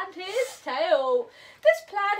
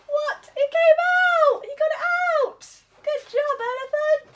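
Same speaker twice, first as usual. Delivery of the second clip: much higher, excited cheering; delighted surprise; 8 semitones higher, faster than usual. Acted. Tiger cheering Elephant for getting the bun out, delighted.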